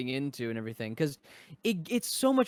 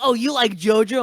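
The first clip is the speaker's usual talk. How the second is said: loud, higher-pitched mock fan; mocking, pushy fan voice; annoying fan; 5 semitones higher, 10 dB louder than usual. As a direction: imitate an over-eager fan, loudly. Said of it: "Those kinds of fans are so annoying".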